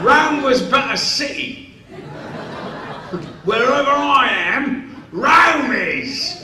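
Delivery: loud, high Cockney declaiming. Retold or imitated. Two actors bored of rehearsing, loud Cockney. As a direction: belt the line like a Cockney barrow boy. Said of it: "we started to do it in Cockney".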